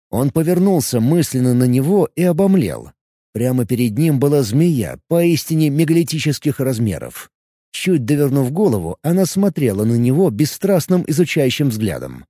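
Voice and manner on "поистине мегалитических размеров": plain reading voice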